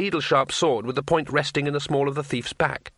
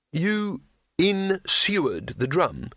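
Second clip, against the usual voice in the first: very slow, higher, deliberate words; slow realisation; 5 semitones higher, much slower than usual. Acted. Wizard working out why the inn burned, slowly. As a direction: spell it out word by word.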